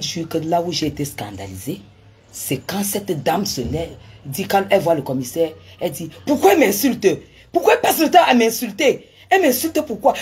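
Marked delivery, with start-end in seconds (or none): 6.3-10.1 high, loud aggrieved protest; indignant complaint. Retold or imitated. The woman complaining to the commissioner, high loud indignant protest.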